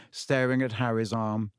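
usual speaking voice

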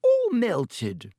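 amazed. A narrator's reading. Pomfrey stunned by the ruined camera. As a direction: let the shock rise. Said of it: "said Madame Pomfrey wonderingly".

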